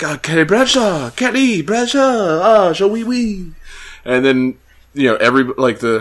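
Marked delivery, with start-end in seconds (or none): 0.0-3.5 put-on higher French accent; French bookstore lady voice. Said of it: "the bookstore lady's like".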